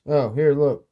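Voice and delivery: ordinary delivery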